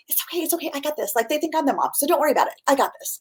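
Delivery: soft, soothing reassurance; comforting. Retold or imitated. Reassuring a frazzled mom at a restaurant, softly comforting. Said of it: "I'm, like, comforting mom".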